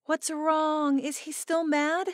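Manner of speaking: the bride checking in on her upset friend; high, anxious friend voice; concerned friend. Acted.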